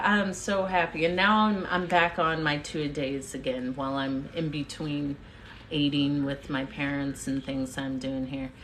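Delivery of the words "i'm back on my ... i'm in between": normal talking voice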